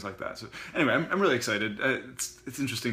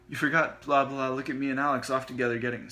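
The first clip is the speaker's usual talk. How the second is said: louder, higher taunting blah blah; teasing retort; 4 semitones higher, 7 dB louder than usual. Acted. Sushi teasing Summer about Alex, taunting. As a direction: tease back to embarrass her. Sushi firing back at sister.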